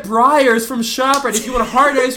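high, loud rant; angry screaming. Real, not acted. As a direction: shout it in outraged frustration. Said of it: "I'm sorry for screaming".

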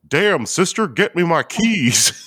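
high, drawn-out cartoon-dad exclamation; mock amazed excitement. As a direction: imitate a thrilled dad, stretching the words. Acting Hank amazed by cookie dough.

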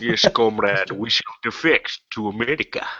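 a lightly done, bad Sean Connery Scottish accent; mock Soviet defector in a shaky Scottish accent; terrible Sean Connery. GM voicing a comrade to the captain, in a bad Sean Connery Scottish accent. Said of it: "It's my terrible Sean Connery".

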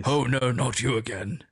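a hint of a weary British accent; British accent impression. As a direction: sigh it in a light British accent. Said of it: "It's quite a good impression".

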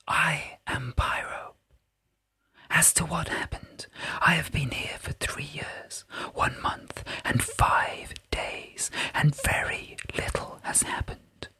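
a tiny sprite whispering close; tinkly bell voice. A narrator's reading. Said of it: "tinkling voice hovered somewhere around her ear".

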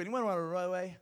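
loud call to the congregation; rally the crowd with a shout; booming motivational speech. Acted.

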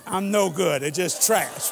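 ordinary talk

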